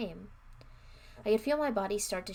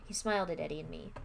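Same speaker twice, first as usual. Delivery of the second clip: plain reading voice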